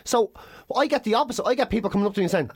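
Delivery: normal talking voice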